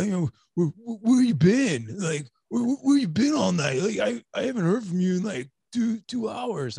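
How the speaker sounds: high, exaggerated nagging dad voice; a kid mimicking a worried dad, hammed up; mock dad voice. Kids imitate the dad asking where they've been in a high voice. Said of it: "I don't think I talk like that".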